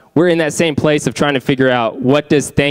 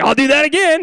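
high, hammed-up eager offer; mock eager; 13 semitones higher than usual. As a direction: play it up like a cheesy volunteer. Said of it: "I'm not gonna be like".

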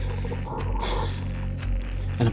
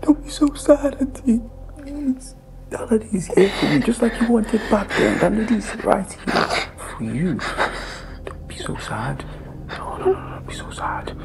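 higher, half-whispered mock comfort; mock soothing; 6 semitones higher than usual. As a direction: coo at her with fake pity. Mock comforting crying aunt.

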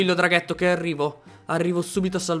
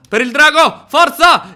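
loud, raised-pitch charging shout; battle cry; 7 semitones higher, 8 dB louder than usual. Real, not acted. Player charges the illagers to free the dragon, battle cry. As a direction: yell it as you charge in.

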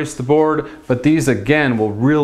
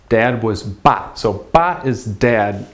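usual speaking voice

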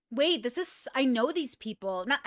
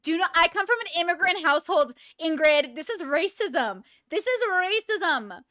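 mock outrage; 5 semitones higher, 6 dB louder than usual.

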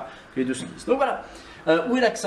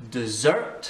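English "dessert" said de-ZERT; stress on second syllable; 2 semitones lower than usual. Shown as a demonstration. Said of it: "Ça c'est accentué ici". (« dessert » accentué sur la deuxième syllabe)

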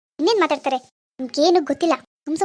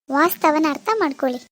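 normal talking voice

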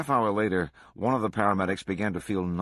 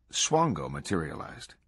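usual speaking voice

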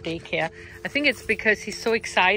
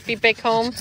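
bright, loud greeting for the puppy; excited dog talk; 3 semitones higher, 7 dB louder than usual. Real, not acted. Owner greeting her dog home, excited and loud. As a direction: hype him up about being home.

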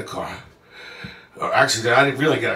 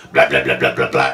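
imitated car noise; 2 semitones lower, 6 dB louder than usual. Comic imitating car after muffler falls off, noisy sputter. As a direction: mimic an engine with no muffler.

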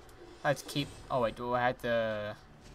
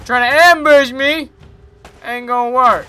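taunting tough guy; 12 semitones higher, 18 dB louder than usual. Pretend. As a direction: challenge them mockingly. Taunting enemies in game.

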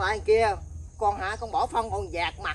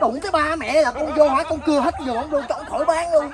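louder, laughing through the words; laughing boast; 4 semitones higher, 5 dB louder than usual. Acted. Son brags to mother about sawing the garden, laughing.

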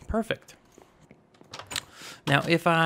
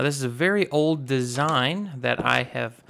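usual speaking voice

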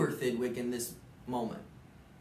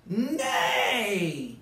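loud, higher chorus of pests; group yell; 7 semitones higher, 5 dB louder than usual. Acted. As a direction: yell it together to outvote him. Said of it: "they all yelled".

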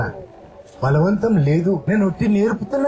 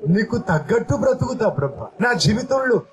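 earnest spoken prayer, pitched up; prayer said aloud; 4 semitones higher than usual. Shown as a demonstration. Preacher leads the congregation's vow to Jesus, earnest prayer. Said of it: "అని బయటికి చెప్పు పలికి".

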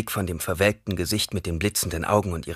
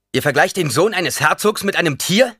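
high, loud indignant outburst; flaring up; 11 semitones higher, 9 dB louder than usual. A narrator's reading. Duke's son flaring up at the old woman, loud and indignant. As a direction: bristle with offended pride. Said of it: "Aufbrausend sagte er".

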